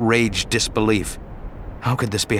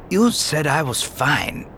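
slow, higher, faint teasing; weak, wounded Obi-Wan; 5 semitones higher, slower than usual. Acted. Dying Obi-Wan chides Anakin, slow and faint. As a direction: tease through pain.

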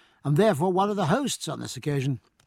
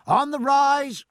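much higher, louder, slow call; 9 semitones higher, 6 dB louder, slower than usual. Acted. Master calls the sharks up over the taffrail: slow loud call. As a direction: hail it over the stern. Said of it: "called the master".

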